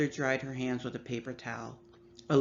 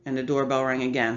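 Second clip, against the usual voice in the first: ordinary delivery, nothing marked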